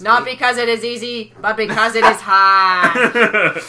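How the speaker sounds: slow, loud JFK oratory; JFK speech imitation.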